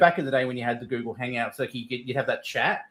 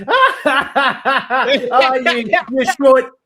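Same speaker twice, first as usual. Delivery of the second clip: high, loud laughing mock insult; high-pitched teasing jeer; Jello's mocking insult; 10 semitones higher, 8 dB louder, much slower than usual. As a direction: cackle the insult like a mocking friend. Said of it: "and Jello goes".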